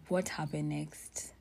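unvoiced, slow whisper; whispered to herself. Real, not acted. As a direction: murmur it slowly, searching memory. Repeats question trying to remember.

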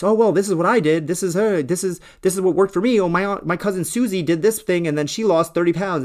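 mock the friend who swears by his diet; smug diet advisor. Mimicking diet advice givers.

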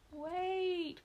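high urgent cry of a trapped mouse; cried out. A narrator's reading. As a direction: call out to stop them, loud and high. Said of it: "cried Mooseberry".

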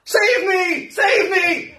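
high, loud shrill-pitched cry for help; mock frantic pleading. Mocking the worshipper crying to a dead idol, high and loud. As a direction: ham up a desperate plea.

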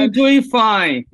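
slow, laughing and encouraging; laughing reassurance. Real, not acted. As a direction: reassure her through a laugh. Host reassures nervous guest.

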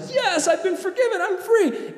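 high, laughing cry of freedom; giddy relief. Retold or imitated. Forgiven debtor rejoicing, high and giddy. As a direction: burst out with relief, almost laughing. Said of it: "the guy is like".

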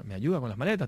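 ordinary talk, nothing marked

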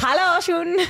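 put-on high-pitched children's-show German greeting; Dora the Explorer. Shown as a demonstration. Cohost plays along with the teasing, German cartoon-host voice. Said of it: "German version of Dora".

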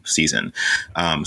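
ordinary talk

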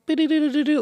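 high blinking light noise; mock blinky sound. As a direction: chirp it like flashing lights. Imitating blinking shoe lights.

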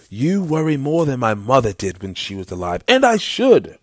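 a touch exasperated, rising to a high outburst; exasperated exchange. A narrator's reading. Lord and Knox bickering over worrying, lightly exasperated. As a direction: start protesting, jump high and loud at the end.

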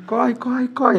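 urgent high shout; being chased. Retold or imitated. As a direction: yell it while fleeing. Running from rival fans.

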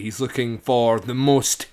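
drawn-out setup before a pause; slowed-down demonstration. Shown as a demonstration. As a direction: stretch out MOST and leave it dangling. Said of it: "you've said that too quick".

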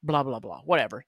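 normal talking voice

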